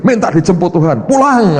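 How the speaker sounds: high, loud mock of the pastor's sing-song; a cheeky impression of Pak Yusak; imitating Pak Yusak. Preacher imitates Pak Yusak telling the sick to go home.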